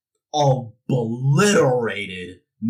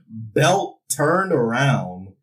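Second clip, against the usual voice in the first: ordinary delivery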